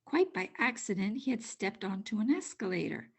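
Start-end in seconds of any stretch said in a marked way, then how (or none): none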